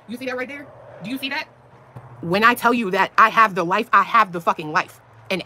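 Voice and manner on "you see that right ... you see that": hushed. Real, not acted. Showing viewers something close.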